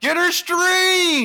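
high, slow, loud catchphrase yell; shouted catchphrase. Pretend. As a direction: belt it out like a comedy tour catchphrase. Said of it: "That's your catchphrase".

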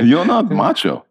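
put-on blunt Israeli accent, high and slow. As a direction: repeat the verdict in an Israeli accent. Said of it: "They just tell it like it is".

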